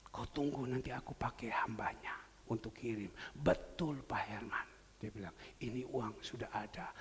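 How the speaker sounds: soft, whispery voice of the poor woman; hushed retelling. Retold or imitated. Poor woman confides to the pastor in a whisper. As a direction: tell the need in a hushed whisper.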